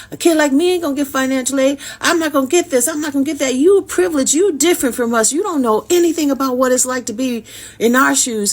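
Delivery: a hint of a kid insisting you're privileged; defiant kid arguing. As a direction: push back hard like an indignant kid. Boy arguing he's underprivileged.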